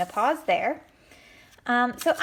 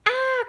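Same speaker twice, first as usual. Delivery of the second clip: a sudden very high shriek of alarm; high cry of fright; 4 dB louder than usual. Acted. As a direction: squeal like a startled pig. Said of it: "cried Little Pig and Big Pug".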